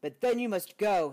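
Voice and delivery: sniffling. Acted. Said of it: "he sniffled".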